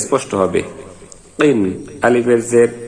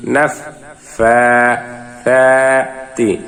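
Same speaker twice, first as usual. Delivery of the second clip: slow, one syllable at a time with pauses; broken syllable reading; much slower than usual. Shown as a demonstration. Teacher reads for learners piece by piece, slow and broken. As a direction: break the verse into separate syllables. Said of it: "ভাঙা ভাঙা উচ্চারণ".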